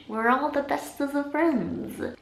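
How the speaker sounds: high, slow, put-on silly voice; playful silly voice. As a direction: stretch it out high in a mock-cutesy way. Playful answer to friend request.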